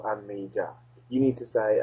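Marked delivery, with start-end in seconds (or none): 0.0-0.7 low, soft and understated; throwaway intro line. Shown as a demonstration. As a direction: drop it in quietly, unimpressed. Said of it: "I can't just go".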